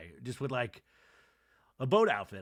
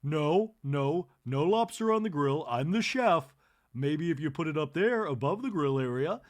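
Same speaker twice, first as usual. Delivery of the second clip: louder, firm correction; bossy correcting; 6 dB louder than usual. Retold or imitated. Kathy bossing the grill chef, louder. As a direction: take charge of the grill. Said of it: "Kathy to the grill chef".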